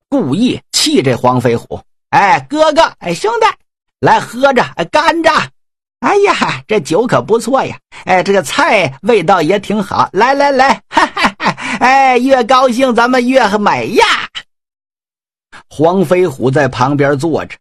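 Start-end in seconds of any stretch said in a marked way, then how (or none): 2.1-14.0 hammed-up carousing to goad their brother; rowdy generals laughing over wine; raucous drinking generals. Generals mock-feast to goad Huang.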